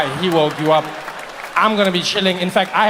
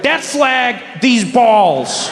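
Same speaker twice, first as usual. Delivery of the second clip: mock patriot; 6 semitones higher, much slower than usual. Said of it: "you were like".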